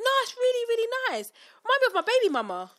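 high, loud mock chat-up voice; imitating the flirty customer. Customer's cheesy line to the cashier, high and loud. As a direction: play the stranger's cheesy compliment big. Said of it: "He said".

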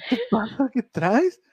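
high, loud questions in mock bafflement; retold startled reaction. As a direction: act out being thrown off, pitch jumping up. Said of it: "me sacó de onda, así como que".

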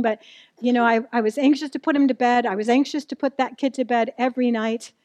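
ordinary talk

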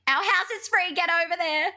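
loud, high, insistent call; excitedly ordering someone over; urgent invitation. Retold or imitated. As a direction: shout it like a friend to the rescue. Friend's urgent invitation, acted.

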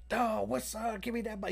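lightly mimicked high-pitched party greeting; eager partygoer. Storyteller voicing Bobby greeting the artist with the bottle, eager mimicry.

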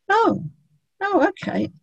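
high, surprised oh okay; surprised owner. Retold or imitated. Owner suddenly understanding, surprised and high. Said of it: "owner's surprised reply".

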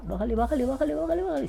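rising high-pitched mimicry of being told to tone it down; hushing imitation, climbing into a squeaky register; Fabi telling him off. Fabi signals the podcaster to keep it down, high mimicked nagging. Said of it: "a la Fabi haciéndome así".

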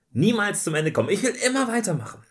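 high, loud and fast; playful whining. Pretend. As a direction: protest like a child who won't stop. Playful protest about ending.

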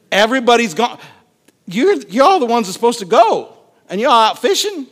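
incredulous, worked-up protest; exasperated complaint. Acted. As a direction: complain in disbelief at the deserters. Acts exasperated leader deserted by team.

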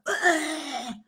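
high, falling agonised groan; mock labour groan. Preacher imitates a lady at a revival meeting, mock agony groan. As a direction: groan like childbirth meets appendicitis. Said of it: "sounded like she was having a mixture of giving birth".

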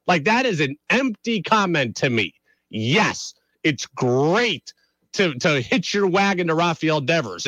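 ordinary talk

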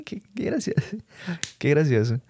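breathless laughing whisper. Real, not acted. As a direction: say it through laughter. Risa tras el chiste. (riendo entre susurros)

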